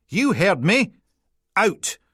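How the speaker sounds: high, loud Peterhead order; grumpy Peterhead PC. Acted. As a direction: jab it at him in broad Peterhead.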